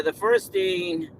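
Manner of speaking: ordinary delivery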